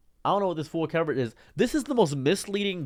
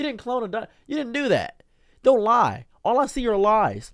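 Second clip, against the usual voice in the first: higher and louder, real indignation; angry outburst; 8 semitones higher, 4 dB louder than usual. Real, not acted. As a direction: snap it out at the liars. Angry rant about dire wolf articles.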